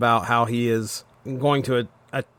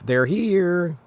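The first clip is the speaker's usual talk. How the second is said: higher-pitched, drawn-out movie-line parody; Poltergeist riff; 6 semitones higher than usual. Retold or imitated. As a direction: imitate the eerie announcement from Poltergeist. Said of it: "Alf doing a poltergeist riff".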